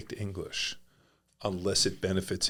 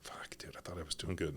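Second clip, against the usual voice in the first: hushed, breathless curse; muttered frustration; 2 semitones lower, 12 dB quieter than usual. Retold or imitated. A student getting pinned, muttering under his breath. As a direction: mutter it to yourself as you get flipped. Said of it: "you're like".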